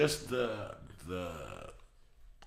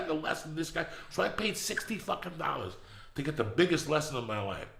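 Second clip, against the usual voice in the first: ordinary delivery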